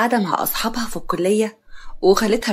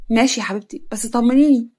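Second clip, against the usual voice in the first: ordinary delivery, nothing marked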